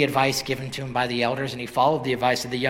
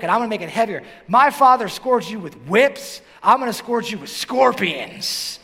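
raised, higher and louder boastful counsel; brash young men; 5 semitones higher, 5 dB louder than usual. Acted. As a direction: voice the cocky friends egging the king on. Young men's brash advice, quoted.

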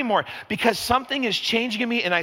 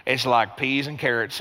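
put-on Southern accent; 6 semitones lower than usual. Pastor back from Atlanta, playful Southern accent. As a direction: lean into a lazy Southern accent. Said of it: "suddenly you wanna talk with a draw".

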